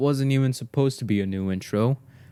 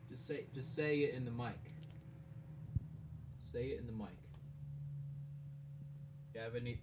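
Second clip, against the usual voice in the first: much softer, coaxing the dog off mic; hushed urging to a pet; quiet coaxing; 21 dB quieter, much faster than usual. Real, not acted. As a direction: murmur to the dog to speak.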